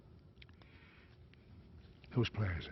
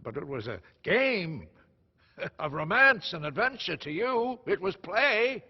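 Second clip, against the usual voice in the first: high, loud scoffing; raised, mocking rebuke; scornful; 8 semitones higher, 6 dB louder than usual. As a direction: dismiss it with mounting scorn. Father scoffs at son's hardship.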